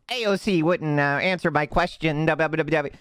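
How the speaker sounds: high-pitched put-on gotcha voice; mock rambling interviewer. Imitates the guy's rambling recording.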